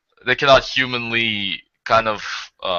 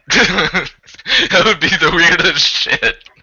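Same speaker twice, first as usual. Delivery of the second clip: laughing; 7 semitones higher, 5 dB louder, much slower than usual. Real, not acted. Laughing at absurd idea.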